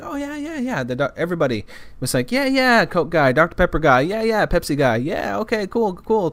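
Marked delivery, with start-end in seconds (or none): 0.0-0.8 high-pitched mock agreement; eager agreement. Vendors all agreeing. 2.3-6.3 high, quick-to-agree chorus imitation; mock agreement. Imitating vendors all agreeing.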